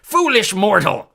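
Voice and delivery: high, loud, slow monster roar; bellowing cyclops. A narrator's reading. Cyclops bellowing at Percy near the cliff. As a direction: boom the insult like a giant. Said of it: "he bellowed".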